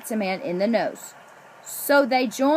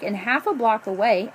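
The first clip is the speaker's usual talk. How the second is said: plain reading voice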